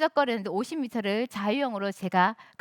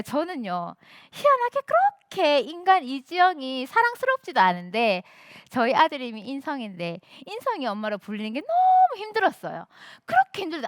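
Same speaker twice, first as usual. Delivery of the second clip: usual speaking voice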